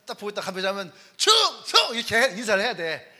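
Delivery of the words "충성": high, loud salute; shouted military salute. Acted. Soldier saluting a passing officer, shouted. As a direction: call out sharply like a soldier on guard.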